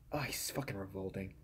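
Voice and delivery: ordinary delivery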